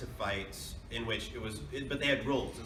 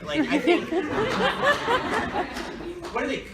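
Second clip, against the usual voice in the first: laughing; 14 semitones higher, 7 dB louder than usual. Real, not acted. Laughing while answering.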